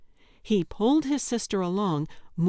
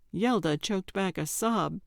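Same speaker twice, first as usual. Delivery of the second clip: ordinary delivery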